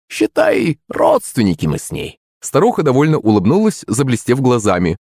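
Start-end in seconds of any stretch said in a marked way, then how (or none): 0.1-2.2 high, coy crone voice; sly hag hinting; old witch voice. Acted. Witch coyly admits kinship.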